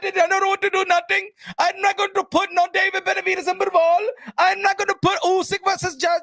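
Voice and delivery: high, sneering boss voice mocking his refusals; mocking Turki Al-Sheikh. Vlogger mocking the promoter refusing the fights fans want, high sneer. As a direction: play the spoiled boss saying no to every fight. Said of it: "His job is not to be like".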